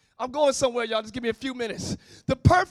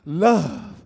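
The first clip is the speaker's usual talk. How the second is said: breathy sigh; 11 semitones lower than usual. Shown as a demonstration. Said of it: "Let your breath come out".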